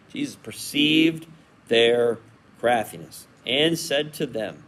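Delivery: ordinary talk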